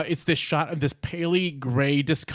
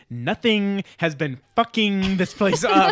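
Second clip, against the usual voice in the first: put-on weird Valley Girl accent, high and drawn out; mock a bizarre accent, stretching the words slowly; Nick Cage, bizarre accent; 5 semitones higher, slower than usual. Dad mocking his daughter's words, bizarre Valley Girl accent. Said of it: "in this weird, bizarre accent".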